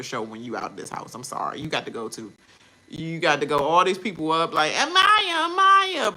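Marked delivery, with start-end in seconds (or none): 4.7-6.2 calling out. Retold or imitated. Fans calling out to Amaya.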